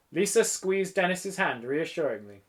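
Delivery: plain reading voice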